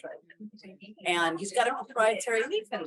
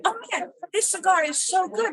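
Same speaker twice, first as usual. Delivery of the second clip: excited customer; 12 semitones higher, 5 dB louder than usual. Retold or imitated. Retelling a customer's praise.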